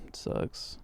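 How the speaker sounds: breathless whispered aside; soft whispered gripe. Real, not acted. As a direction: whisper it to yourself.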